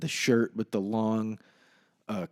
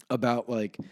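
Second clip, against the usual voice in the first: ordinary talk, nothing marked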